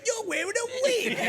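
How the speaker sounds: high, loud playground jeer through laughter; mocking kids' taunt. Schoolkids taunt him about his wig, high laughing jeer. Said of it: "the kids just went".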